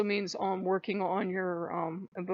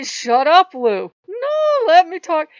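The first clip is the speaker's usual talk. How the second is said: loud, high-pitched mock squabble; exasperated shout; 9 semitones higher, 13 dB louder, slower than usual. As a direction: shout down the one interrupting you. Acted squabble with interrupting Lou.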